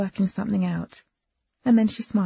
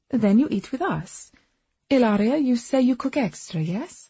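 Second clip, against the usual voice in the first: put-on Eastern European accent; 6 semitones higher, 4 dB louder than usual. A narrator's reading. Agnes voiced with accent.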